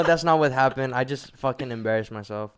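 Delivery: ordinary talk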